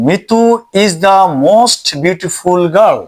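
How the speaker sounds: very slow, spaced words; slow dictation. Shown as a demonstration. Teacher reading example sentence to students, very slow. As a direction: spell it out for the class.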